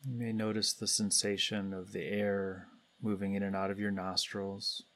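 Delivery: calm, unhurried guidance with long pauses between phrases; guided meditation. Real, not acted. Meditation leader guiding everybody to turn attention inward, calm and spacious. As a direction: lead the group gently inward, leaving space after each phrase.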